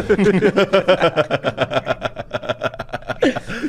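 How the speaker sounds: real drawn-out laughing fit; long laugh. Real, not acted. Laughing that only the sneakers were left, long laugh. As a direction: crack up mid-story.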